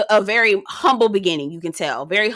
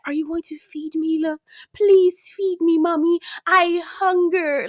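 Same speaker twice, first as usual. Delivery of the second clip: higher, slow pleading puppy voice; begging dog voice; 7 semitones higher, slower than usual. Retold or imitated. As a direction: beg for food like a hungry dog. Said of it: "Dogs always give you that".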